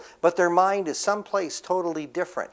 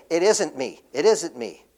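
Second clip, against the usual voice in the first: "It isn't me" pressed firm with stress on "isn't"; insistent denial; 4 dB louder than usual. Shown as a demonstration. Preacher voicing the offended disciples, firm and insistent. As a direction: hit "isn't" hard both times. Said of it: "They're saying".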